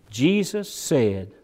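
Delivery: normal talking voice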